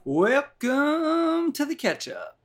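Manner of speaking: high, slow sung-out host welcome; drawn-out radio host opener; show intro. Acted. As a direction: announce the show like a jingle.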